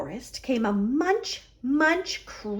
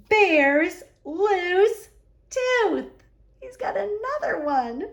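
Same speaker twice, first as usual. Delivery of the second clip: surprised reveal; 6 semitones higher than usual. A narrator's reading. Twist ending.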